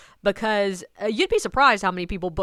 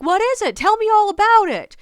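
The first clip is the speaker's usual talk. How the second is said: very high, loud, gushing interest; flirty stranger; 12 semitones higher, 9 dB louder than usual. Retold or imitated. Man quizzing the guide about the tour, flirty. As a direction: imitate an over-keen flirt, loud and pitched up. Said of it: "he's trying to flirt with me".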